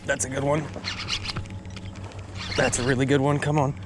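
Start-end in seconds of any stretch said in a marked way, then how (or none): none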